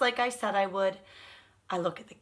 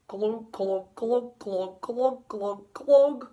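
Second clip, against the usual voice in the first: lightly voiced fish going glug glug; fish glugging; 4 dB louder, much slower than usual. Fish glugs along to the tuba music. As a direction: mouth the glugs like a goldfish singing. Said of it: "he mouths".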